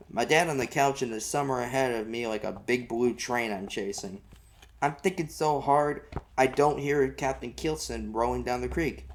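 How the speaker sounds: plain reading voice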